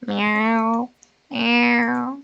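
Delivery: high put-on kitty meow; nervous cat voice. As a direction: meow like a timid kitten. Streamers meowing at tiger lady.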